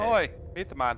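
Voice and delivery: cockney Alton. Acted. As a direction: bark it at the party. GM voices Alton urging.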